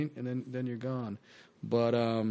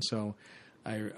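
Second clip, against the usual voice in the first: ordinary delivery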